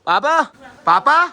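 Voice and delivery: loud high call for papa; calling papa loudly to wake him. Real, not acted. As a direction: call him from across the house. Calling out to father.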